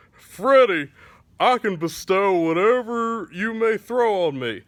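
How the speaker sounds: chest out, mildly heroic; brave challenge. Acted. Bucky stepping up to Freddy, proudly bold. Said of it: "puts his chest out and says".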